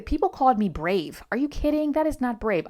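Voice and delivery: loud, high-pitched disbelief; incredulous retort. Retold or imitated. Retelling her reply to being called brave, incredulous.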